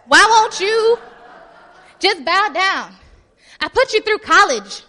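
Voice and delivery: loud, high, indignant shouting; acted outrage at full volume; angry king. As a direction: yell like a benefactor who's been snubbed. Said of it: "Be angry, be angry".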